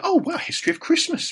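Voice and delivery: very high, kindly put-on voice; Colin Firth. Actor looking at a gifted book, kindly mock reaction. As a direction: imitate a star kindly taking an interest. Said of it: "very kindly he said".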